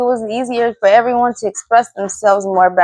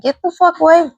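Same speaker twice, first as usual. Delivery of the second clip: angry outburst; 6 semitones higher, faster than usual. Retold or imitated. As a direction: shove them off with your voice. Outburst at aggressive men.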